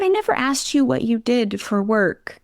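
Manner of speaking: high, airy casual barber voice; imitating the barber. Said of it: "one day he's like".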